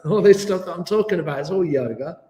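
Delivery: ordinary talk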